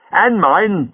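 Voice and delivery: a touch of anger as she cuts in; angrily. A narrator's reading. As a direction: warrior woman backs the doctor, mildly angry. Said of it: "said Leela angrily".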